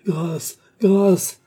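supplication aiguë qui enfle au second mot; cri suppliant. A narrator's reading. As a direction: implorer grâce en criant. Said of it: "cria le malheureux". (cri de détresse joué, de plus en plus fort)

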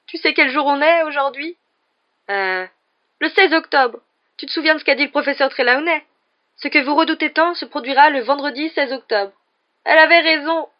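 high, loud tearful wail; tragic sobbing. A narrator's reading. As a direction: lament with theatrical despair. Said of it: "sanglota Lavande d'un air tragique".

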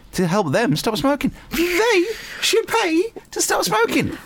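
very high falsetto outburst; drawn-out, sky-high protest; squeaky exasperation. Acted. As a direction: squeal it slowly in mock indignation. Exasperated rant on who pays.

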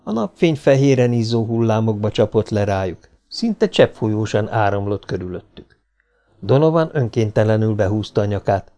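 ordinary delivery

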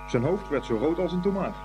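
usual speaking voice

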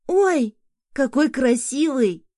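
high, loud, slow cry of delight. A narrator's reading. Son seeing the fat cat, delighted cry. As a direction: gush over the cat. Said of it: "восторженный голос сына".

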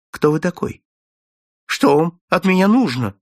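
a startled, frightened question; trembling voice. Acted. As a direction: ask in alarm, caught off guard. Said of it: "спросил он дрожащим голосом". (испуганно, дрожащим голосом)

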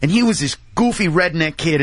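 ordinary talk

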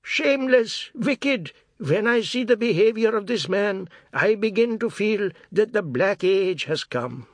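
put-on high, loud old man's abuse; old man mumbling. A narrator's reading. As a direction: rail at him like an old man. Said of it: "the old man muttered abuse".

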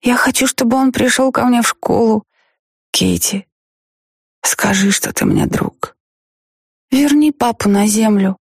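put-on high, insistent childish plea; pleading little girl. A narrator's reading. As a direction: beg like a small daughter.

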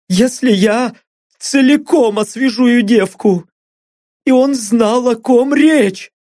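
high, shaky pleading captive; frightened blond. Acted. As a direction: talk fast to save your skin. Blond's voiced confession.

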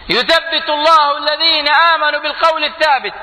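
high, ringing Quran quote; preaching recitation. Real, not acted. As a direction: intone the verse to the hall. Quotes Quran verse, chanted high.

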